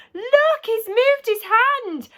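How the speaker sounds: high, loud cry of amazement; excited cry. A narrator's reading. Parents see their son's hand move, excited cry. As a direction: call it out high, stretching the last words.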